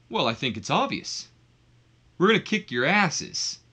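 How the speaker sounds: lightly acted wrestler comeback; mock promo threat, rising at the end; Al Snow. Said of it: "that's when Al Snow takes the mic".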